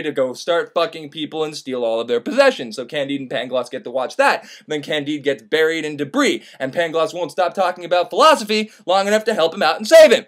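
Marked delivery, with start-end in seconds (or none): none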